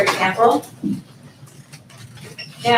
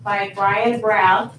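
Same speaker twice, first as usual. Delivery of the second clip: dictating; 3 dB quieter, slower than usual. Shown as a demonstration. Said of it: "notice how it's gonna keep".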